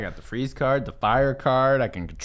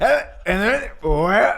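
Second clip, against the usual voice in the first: high, hesitant mock narrator losing his place; mock confused narrator; 8 semitones higher, 4 dB louder, slower than usual. Player mocks the stumbling narrator with a high, confused voice. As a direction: mimic a narrator who lost the thread. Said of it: "He's like".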